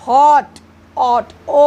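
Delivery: हॉट broken down to ऑट, then a long ओ; teacher sounding out हॉट, ऑट, ओ; vowel sound isolated. Shown as a demonstration. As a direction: pull the vowel out of हॉट and hold ओ. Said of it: "ओ sound आ रहा है".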